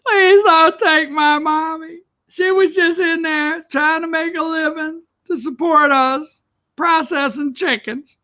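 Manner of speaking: hammed-up tearful child begging; imitating a crying girl whose mother was arrested; mock crying child. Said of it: "that's crying".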